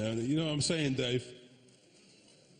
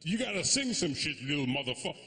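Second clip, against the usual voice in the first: Indian dad. Retold or imitated. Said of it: "he's a".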